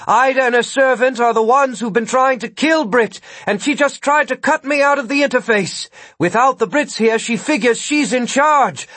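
high, loud, urgent call for help; shouting. A narrator's reading. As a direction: shout an emergency alarm at high pitch. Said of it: "Gwen shouted".

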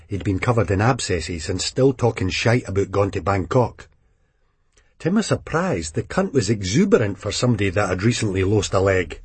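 usual speaking voice

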